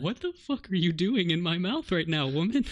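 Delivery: shocked and angry, half-shouting at the woman; outraged exclamation. Retold or imitated. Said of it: "And I'm like".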